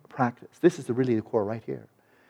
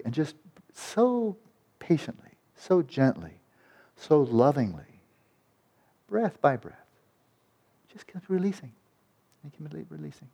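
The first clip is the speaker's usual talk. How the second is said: guided meditation; 4 semitones higher than usual. Shown as a demonstration. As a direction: ease the listener into releasing, one breath at a time. Slow soothing guided release.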